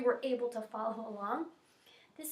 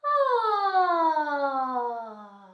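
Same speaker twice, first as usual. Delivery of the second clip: long, high, loud sigh sliding down; big descending vocal sigh; 5 semitones higher, 13 dB louder than usual. Shown as a demonstration. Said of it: "sigh on ah".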